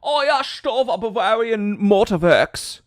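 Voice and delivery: put-on loud, high caricature German accent; mock German accent. Streamer doing a loud mock German accent. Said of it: "my caricature of a German person".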